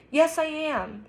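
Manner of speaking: high-pitched, lightly acted sob; sobbing. A narrator's reading. Olivia contradicting Justin's comfort, mildly acted sobbing. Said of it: "she sobs".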